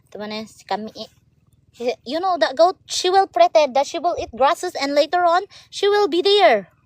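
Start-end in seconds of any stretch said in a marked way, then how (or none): 2.1-6.7 excited telling. Real, not acted. Excitedly telling about the goat.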